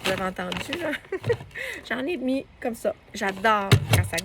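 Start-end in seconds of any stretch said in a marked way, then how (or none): 1.0-1.9 light laugh. Real, not acted. Laughing at the loud pour.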